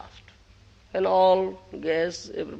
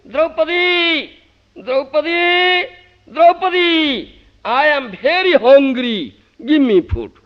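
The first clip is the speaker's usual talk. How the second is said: loud, high calling out, breathless; shouting; 11 semitones higher, 12 dB louder than usual. Acted. Krishna shouting for Draupadi as he arrives running. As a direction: call out her name, desperate and hungry. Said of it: "came running breathlessly and shouting".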